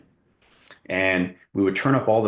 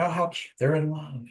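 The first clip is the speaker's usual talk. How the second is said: higher, sugary mock gush over the lovers; 7 semitones higher than usual. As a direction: gush like a sentimental moviegoer.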